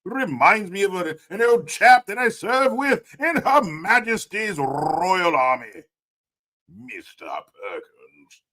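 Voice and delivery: hammed-up British old army officer; stiff-upper-lip British. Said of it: "Like your stiff upper lip British accent".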